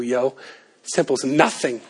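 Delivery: low, quiet, tough street tone; tough Stephen voice. Acted. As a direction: play Stephen as a tough guy brushing it off. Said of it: "I think that's how he talked to him".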